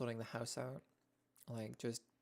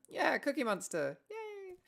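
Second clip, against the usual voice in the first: playful cheer; 14 semitones higher, slower than usual. Real, not acted. Cheering at Cookie Monster showing.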